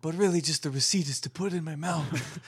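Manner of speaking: mumbled movie godfather voice; Marlon Brando. Acted. Podcaster taking a turn at impressions, Brando godfather voice. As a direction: put on an ageing mob boss's voice. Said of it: "do Marlon Brando impressions".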